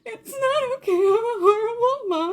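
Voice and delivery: high, crying wail; mock sobbing. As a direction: wail it like a wrecked mom. Retold sobbing reply.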